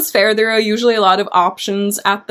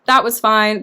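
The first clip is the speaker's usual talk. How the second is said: normal talking voice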